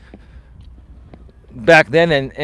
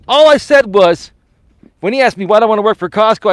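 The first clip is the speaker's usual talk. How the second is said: loud, worked-up retelling; excited; 6 semitones higher, 6 dB louder than usual. Real, not acted. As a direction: tell it with rising excitement.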